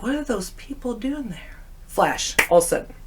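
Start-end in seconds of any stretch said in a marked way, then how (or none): 0.0-1.6 hushed. Retold or imitated. Hiding behind bar at night.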